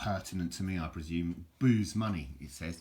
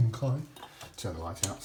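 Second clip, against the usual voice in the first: normal talking voice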